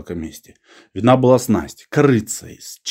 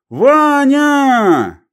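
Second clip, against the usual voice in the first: long, high, loud call; shouting; 5 dB louder than usual. A narrator's reading. Old man summoning his friend by first name, shouting. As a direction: yell the name out, stretched long. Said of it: "и крикнул".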